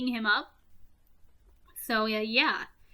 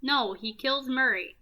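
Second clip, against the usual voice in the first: ordinary talk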